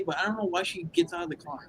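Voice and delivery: ordinary talk, nothing marked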